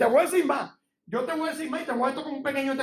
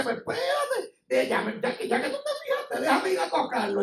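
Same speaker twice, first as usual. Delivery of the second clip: high-pitched, halting comic devil; the devil scheming; 8 semitones higher than usual. Acted. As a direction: play an eager little devil. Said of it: "cuando el diablo dice".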